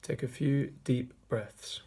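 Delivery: measured meditation voice with long pauses; guided meditation. Acted. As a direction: guide listeners gently inward, leaving long silences between phrases. Leads a meditation.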